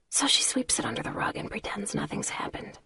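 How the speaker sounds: bitter, unvoiced whisper. A narrator's reading. Lana continues whispering.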